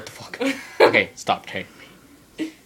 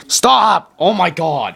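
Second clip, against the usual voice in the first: loud, high yell; shouting stop; 7 semitones higher, 10 dB louder, much slower than usual. Real, not acted. Shouting at friend.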